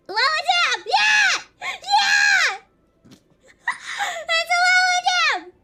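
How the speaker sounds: high, loud shouting cheer; excited cheering. Real, not acted. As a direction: yell with glee. Cheering dab success.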